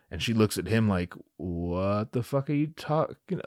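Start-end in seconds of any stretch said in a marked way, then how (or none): 1.4-3.4 slow, softer mock bewilderment; baffled. Leia stares at dramatic Luke, baffled. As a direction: draw it out in mock confusion.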